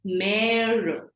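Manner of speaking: "mère" with a long, leaned-on eh; stressed A sound. Shown as a demonstration. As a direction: hold the vowel in "mère". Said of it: "stressing on the A sound".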